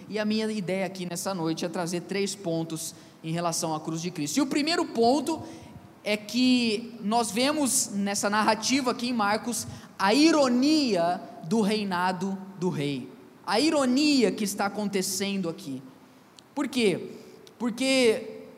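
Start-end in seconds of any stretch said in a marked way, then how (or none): none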